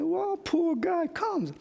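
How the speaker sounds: put-on very high, slow teasing old lady voice; high, drawn-out granny tease; mock old lady. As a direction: coo it like a delighted grandma. Old ladies teasing him.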